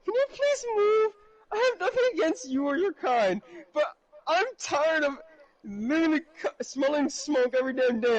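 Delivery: very high, pleading mock yell; high-pitched, hammed-up complaint; yelling neighbor. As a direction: play a fed-up neighbor calling out in a high voice.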